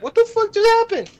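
very high squeaked outburst; mock stunned disbelief. Storyteller mimics bullies stunned by the twist, mock disbelief. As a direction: imitate a shocked onlooker, voice shooting way up.

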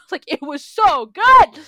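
very loud, high, drawn-out gush; slow, overexcited holler of praise; excited shouting. Real, not acted. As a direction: burst out yelling how much you love it. Gushing, then says sorry for outburst.

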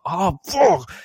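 strangled curse rising to a yell; pained outburst. Retold or imitated. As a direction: burst out from sharp pain. Slams head in pain.

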